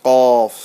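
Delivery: "কফ" dark, loud and held; deep, heavy QAF. Shown as a demonstration. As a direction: make "কফ" dense from the back of the mouth. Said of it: "আওয়াজটা গাঢ় হবে".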